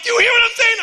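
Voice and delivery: frustrated caller shouting. Retold or imitated. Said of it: "I'm like".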